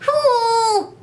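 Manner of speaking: high howling wolf cry; werewolf howl. Pretend. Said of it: "That's my werewolf howl".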